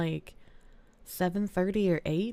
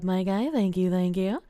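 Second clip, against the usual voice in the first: normal talking voice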